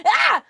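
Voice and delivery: high, loud "Ah!"; loud cry of frustration; frustrated shout. Real, not acted. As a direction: blurt out in annoyance. Frustrated outburst.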